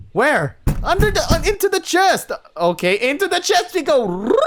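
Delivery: high, loud flustered scramble; panicked. Acted. Narrator scrambling to hide in the chest, panicked.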